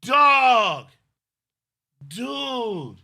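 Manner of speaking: high, loud shouts of disbelief; ecstatic shout. Real, not acted. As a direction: scream with joy at the surprise gift. Stunned by a hundred dollar super chat.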